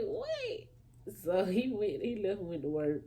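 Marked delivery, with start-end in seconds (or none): 0.0-1.1 very high stunned what; squealed what. Retold or imitated. Uncle guessing she is pregnant, high stunned squeal. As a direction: shriek it in disbelief. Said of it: "I was like".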